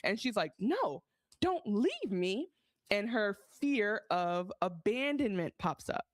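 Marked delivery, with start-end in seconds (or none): 0.6-2.5 slow, higher begging voice; dragged-out childlike plea; pleading girl. Retold or imitated. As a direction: beg him not to go. Daughter begging him to stay.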